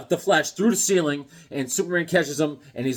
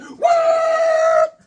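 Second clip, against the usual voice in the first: much higher, louder, drawn-out what; shocked yell; 8 dB louder than usual. Retold or imitated. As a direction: scream it in disbelief. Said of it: "I was like".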